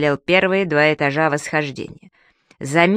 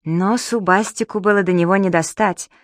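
usual speaking voice

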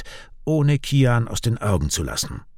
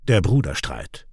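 usual speaking voice

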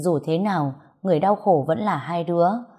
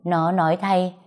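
ordinary delivery

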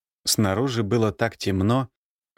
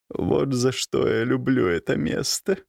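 raspy voice; 3 semitones higher than usual. A narrator's reading. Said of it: "хриплым голосом сказала".

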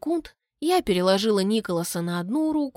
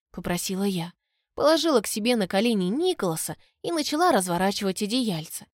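plain reading voice

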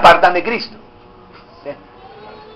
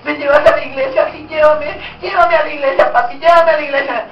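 put-on higher-pitched child begging to go; pleading child; 6 semitones higher than usual. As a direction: beg papi like an eager kid. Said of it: "los hijos los que dicen".

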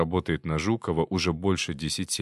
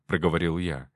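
ordinary delivery, nothing marked

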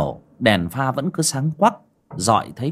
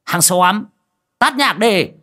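much higher and louder command; barked order; 9 semitones higher, 5 dB louder, slower than usual. A narrator's reading. Host shouting at the staff to stop the music, loud bark. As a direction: snap the order to kill the music. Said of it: "Ông quay lại quát".

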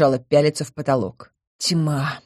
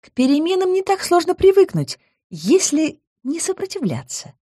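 high, knowing nymph voice; mysterious smile; 13 semitones higher than usual. A narrator's reading. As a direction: say it with a secret smile. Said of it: "с загадочной улыбкой".

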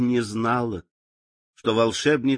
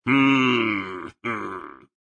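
grumbling witch; 3 semitones lower than usual. A narrator's reading. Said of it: "проворчала волшебница".

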